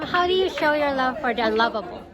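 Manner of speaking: usual speaking voice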